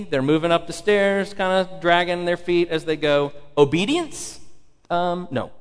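usual speaking voice

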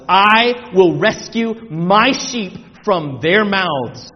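forceful divine decree; loud, deliberate pulpit proclamation; preaching scripture. A narrator's reading. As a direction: declare it in heavy, separated phrases.

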